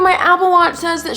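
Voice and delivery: high whiny mock viewer. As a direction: parody a know-it-all viewer. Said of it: "you guys are like".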